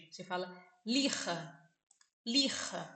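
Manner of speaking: lija with a throaty J, like a Brazilian R, lixa; Spanish J sound. Shown as a demonstration. Teacher contrasts Portuguese lixa with Spanish Lija, the J like a throaty R. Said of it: "o J aqui tem som de R".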